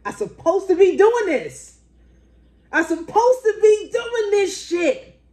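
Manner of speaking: slow, high, loud declaration; savoring the sign of fate; drawn-out elation. Retold or imitated. Realizing she belongs at the school, slow high elation. Feels destined.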